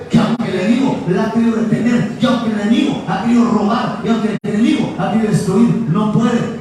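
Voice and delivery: loud, driving sermon climax; full-force preaching. Real, not acted. Preacher assures congregation the enemy cannot stop it, full-force preaching.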